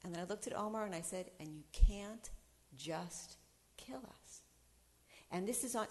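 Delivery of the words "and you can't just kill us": slow, dropping to a whisper; intense hushed warning. Retold or imitated. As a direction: whisper it slowly, deadly serious. Confronting her father's attacker.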